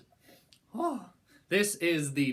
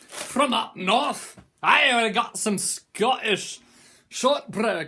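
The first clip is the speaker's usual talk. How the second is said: slow, louder put-on Scottish accent; 8 semitones higher, 10 dB louder, much slower than usual. Unboxing the shortbread for viewers, in a hammed-up Scottish accent. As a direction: announce it like a proud Scot, drawn out and loud. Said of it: "I'm gonna stop the accent".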